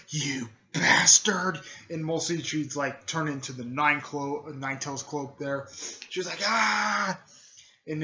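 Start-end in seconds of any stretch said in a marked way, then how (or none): 0.1-1.6 loud, drawn-out acted outburst; angry cry. Sister at her brother's attacker, angry cry. 6.4-7.2 high, loud "ah" yell; acted battle cry. Sister turning into the Nine Tails, battle cry. Retold character cries out attacking.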